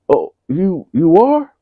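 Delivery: mock a startled son; stunned son. Son surprised by news.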